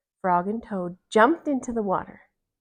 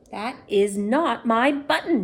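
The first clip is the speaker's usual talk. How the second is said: shouted; 2 semitones higher, 6 dB louder than usual. A narrator's reading. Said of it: "shouted Toad".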